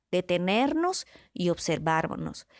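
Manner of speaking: slow, each word laid down deliberately; slowed-down teaching repetition; slow emphatic repeat. Shown as a demonstration. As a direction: repeat it slowly so it sinks in. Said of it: "Siempre practiquemos el detenernos y observarnos".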